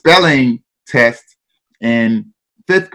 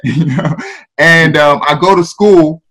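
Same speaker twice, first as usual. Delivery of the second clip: ordinary delivery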